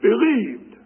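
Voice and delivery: "believed" set apart and drawn out; stress on believed. Shown as a demonstration. Preacher showing Galatians' emphasis, stressing "believed". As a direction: lean firmly on "believed". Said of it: "the emphasis is on the word believed".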